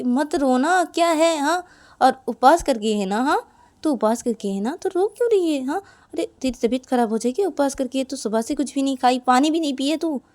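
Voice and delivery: insistent, animated scolding; sisterly fussing. Acted. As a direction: nag a crying younger sister with care. Sister fusses over crying, fasting girl.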